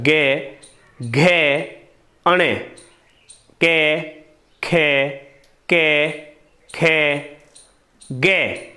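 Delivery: गे, घे, कै, खे each spoken alone with pauses; consonant sounds demo. Shown as a demonstration. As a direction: sound them out one by one. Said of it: "गे में ज़्यादा कंपन होता है". (एक-एक व्यंजन रुककर साफ़ बोलना)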